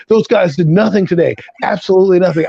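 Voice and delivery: normal talking voice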